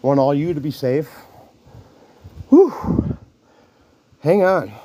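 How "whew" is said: a real winded whew; out of breath exhale. Real, not acted. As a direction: blow out hard, short of breath. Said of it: "I am out of breath".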